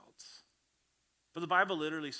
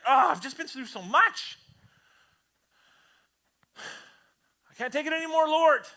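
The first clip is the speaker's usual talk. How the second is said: high, loud weary moan to God; self-pitying lament; 11 semitones higher, 13 dB louder than usual. Retold or imitated. Believer complaining to the Lord, high loud weary lament. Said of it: "we become these victims".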